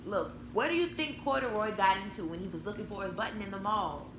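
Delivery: plain reading voice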